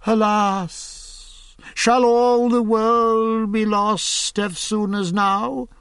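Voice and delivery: mock despair to fool the carpenter; hammed-up sorrow; sighing lament. A narrator's reading. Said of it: "gan for to sigh sore".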